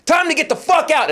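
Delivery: retold bellow at a crowd; mock yell. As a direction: order them out over the din. Acting himself yelling at crowd.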